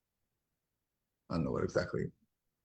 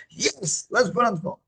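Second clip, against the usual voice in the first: high-pitched eager yes; very excited; 12 semitones higher than usual. Retold or imitated. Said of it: "Like, very excited".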